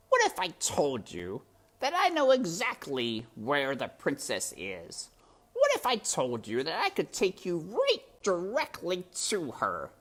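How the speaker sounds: high, purring villain rat, mockingly weary; sly rat voice. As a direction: play the sly rat toying with the mouse. Rat Botticelli's voice in story.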